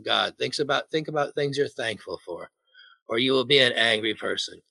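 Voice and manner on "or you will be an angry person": ordinary talk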